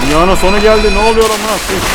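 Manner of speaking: high, loud, panicked shout; panicked yell. Real, not acted. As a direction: yell in real fright at the screen. Panicking at a game scare.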